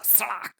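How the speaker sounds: high-pitched vocal whip crack; whip crack sound. Acted. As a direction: imitate a lash with the voice, pitched high. Narrator voices the whip crack. (कोड़े की तीखी सड़ाक)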